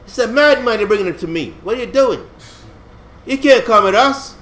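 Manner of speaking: high, loud indignant protest; mock outrage. Philistine princes protesting to their king, loud mock outrage. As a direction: scold the king like he's crazy. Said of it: "I said".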